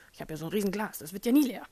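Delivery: loud, fast and cocky; smug mock confidence. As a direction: brag like it will never run out. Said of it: "der Meinung war".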